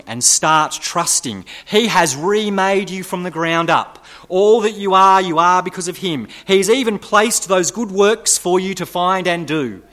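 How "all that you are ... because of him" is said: louder, higher proclamation; emphatic. Acted. Preacher driving the point home, loud and high. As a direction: proclaim it with force. Preacher repeats key line.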